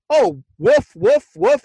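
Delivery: hammed-up dog barks from the creepy boy; mock woofing. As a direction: woof like a kid pretending to be a dog. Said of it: "woofing like a dog".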